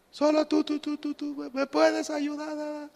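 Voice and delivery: high cry with syllables repeating and fading; mountain echo. Retold or imitated. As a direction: imitate an echo dying away. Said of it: "Pues se oía el eco".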